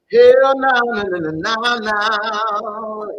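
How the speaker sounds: loud, high, drawn-out hell no; exaggerated wailing denial, stretched out; emphatic refusal. Real, not acted. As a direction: belt out a long string of no's. Emphatic playful answer to pregnancy question.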